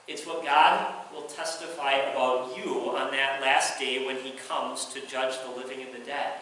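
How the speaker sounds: ordinary delivery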